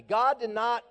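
loud, rising call to the crowd; called out for repeating. Shown as a demonstration. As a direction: lead the congregation in it. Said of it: "So repeat that after me".

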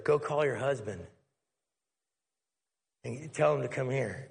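quietly direct, a gentle instruction; lightly voiced calm Jesus. Jesus voiced in retold scene.

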